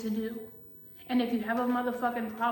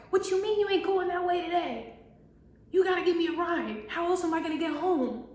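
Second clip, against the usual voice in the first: higher-pitched indignant mock protest; mock-outraged guilt trip; guilt-tripping coworker; 6 semitones higher than usual. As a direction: act out a pushy coworker demanding a ride. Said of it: "guilt tripping him".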